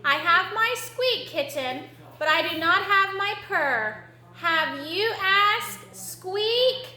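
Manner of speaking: high, squeaky little mouse; high mouse voice slipping into squeaks; nervous mouse. Acted. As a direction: answer haltingly as a timid mouse. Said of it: "said Mouse nervously".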